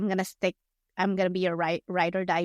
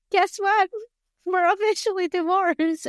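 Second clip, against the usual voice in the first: high, bright mock announcement; imitating her breezy divorce news; mock chirpy announcement; 11 semitones higher, 4 dB louder than usual. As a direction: toss it off like happy news. Mocking her flippant announcement.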